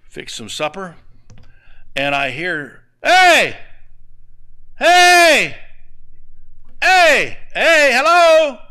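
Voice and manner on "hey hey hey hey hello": calling out. Retold or imitated. Neighbors calling out from street.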